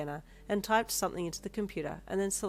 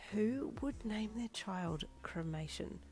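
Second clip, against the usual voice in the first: hushed unvoiced whisper of disbelief; whispered inner shock; 4 semitones higher, 7 dB quieter, much slower than usual. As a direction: breathe the thought under your breath.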